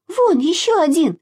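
put-on high, eager little-girl shout; excited call. A narrator's reading. Masha spots another flower and calls out. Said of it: "крикнула Маша".

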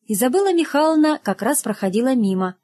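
ordinary delivery, nothing marked

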